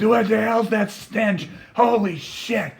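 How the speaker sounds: high, slow, horrified cry; hammed-up revolted guard; disgusted outcry. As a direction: reel back gagging at the smell. Guard gagging from the car stench.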